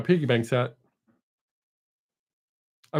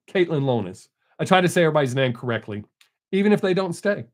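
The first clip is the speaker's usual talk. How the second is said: ordinary talk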